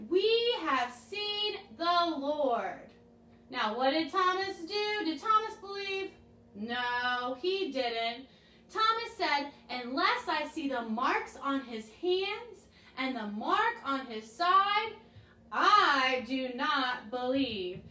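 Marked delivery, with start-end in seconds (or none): 0.1-2.8 slow, deliberate line. Shown as a demonstration. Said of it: "they had told Thomas". 9.7-17.8 slow doubting line. Shown as a demonstration. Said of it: "say that with me as Thomas".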